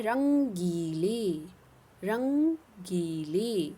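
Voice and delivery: रंगीले stretched out syllable by syllable; slow careful diction. Shown as a demonstration. Teacher drills रंगीले slowly for the class. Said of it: "शब्दों पर ध्यान देना".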